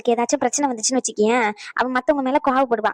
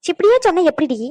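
pleading exclamation; 5 semitones higher, 4 dB louder than usual. Acted. Grandmother pleading about marriage.

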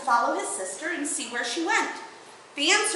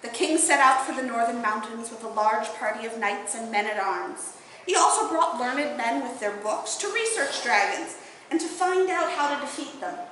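ordinary delivery

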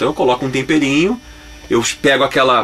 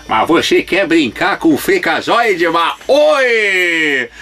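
Silvio Santos impression; 3 semitones higher, much slower than usual. Freakazoid in a cartoon doing a Silvio Santos bit. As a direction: ham up the game-show host calling out. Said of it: "a primeira vez que eu imitei o Silvio Santos".

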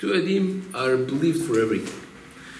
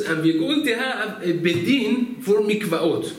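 high, slow, drawn-out proclamation; retold announcement; 7 semitones higher, 4 dB louder, slower than usual. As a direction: announce it grandly and slowly. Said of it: "and then he says".

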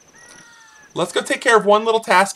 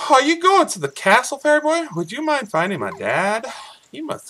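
much higher put-on farm girl voice; lightly voiced girl, higher pitched; Malon voice; 10 semitones higher than usual. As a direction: read her lines like a sweet young girl. Voicing Malon's dialogue.

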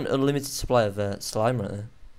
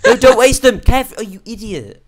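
loud, high, outraged yell; shouted scolding; 10 semitones higher, 11 dB louder than usual. Real, not acted. Player stops Kev wasting items, shouted scolding. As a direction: yell at your friend in exasperation.

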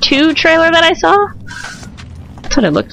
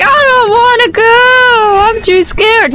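whiny scared figure; 9 semitones higher than usual. Acted. Scared statue refusing an order, whiny. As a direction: plead like a frightened child, hammed-up. Voices frightened character in mock dialogue.

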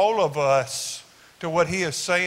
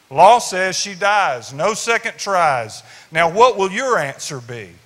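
stern loud declaration; 8 dB louder than usual. Acted. Accusers' voice in the poem.

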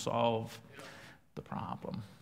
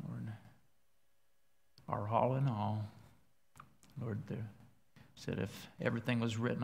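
quiet prayer; 9 dB quieter than usual. Real, not acted. Preacher praying quietly before his sermon. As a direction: pray softly before preaching.

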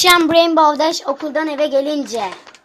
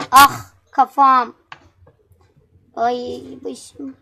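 slow, louder groaning complaint; mock groan of pain; 5 dB louder, much slower than usual.

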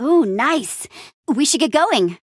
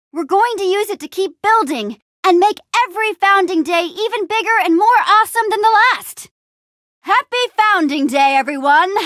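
high, rallying proclamation; rally the crowd with big excitement; fired-up leader's address; rousing speech; 9 semitones higher, 4 dB louder than usual. Acted.

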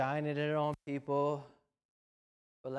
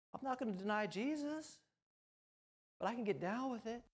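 mock carefree compromiser; 6 semitones higher than usual. Mocking a churchgoer who tells the pastor to relax, in a mock breezy voice. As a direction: play the easygoing compromiser, building up.

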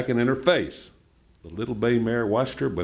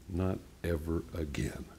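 low, very soft, measured promise; hushed reassurance to a frightened animal; soft murmur to a horse; 5 semitones lower, 10 dB quieter than usual. A narrator's reading. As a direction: say it gently and quietly, pitched down. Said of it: "started whispering to her".

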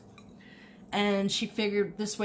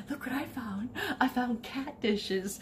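playful reveal; 6 semitones higher than usual. Retold or imitated. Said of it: "I said".

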